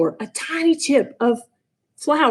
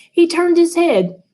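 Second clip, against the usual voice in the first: usual speaking voice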